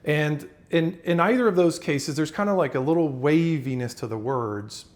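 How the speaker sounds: normal talking voice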